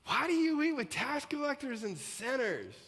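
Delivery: a scandalised religious leader calling out the guest list; indignant complaint. Acted. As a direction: hurl the accusation up high and loud. Pharisees complain, preacher reading loudly.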